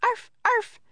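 high yappy little dog bark; puppy barking. A narrator's reading. Little dog Teddy barks agreement, high yaps. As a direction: yap like a tiny dog. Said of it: "Teddy barked".

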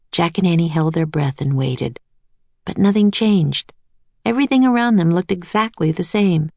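ordinary delivery, nothing marked

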